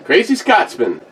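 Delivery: bright high call to a pet. Real, not acted. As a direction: sing out his name from across the room. Calling out to Scotsman.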